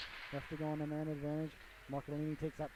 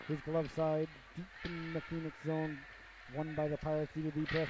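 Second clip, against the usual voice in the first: normal talking voice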